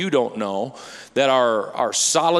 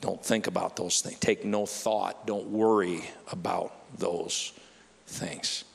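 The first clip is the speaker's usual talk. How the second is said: hushed preaching; 7 semitones lower, 8 dB quieter than usual. Acted. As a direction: draw them in close and hush. Soft intimate preaching.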